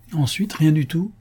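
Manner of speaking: lightly acted lively interruption; brisk interjection. A narrator's reading. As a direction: cut in briskly, dismissing the matter. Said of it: "dit vivement madame Brice en entrant".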